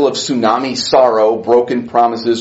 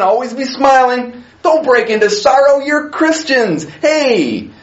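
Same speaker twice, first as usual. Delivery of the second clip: higher, bouncy fake positivity; mock pep talk; 7 semitones higher than usual. Preacher parodying what Jesus did not say, chirpy mock pep talk. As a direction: play a cheesy motivational speaker. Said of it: "What did he say after that?".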